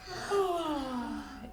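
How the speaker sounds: soft drawn-out pretend yawn; mock yawn. Shown as a demonstration. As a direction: yawn big for the kids. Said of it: "you can yawn".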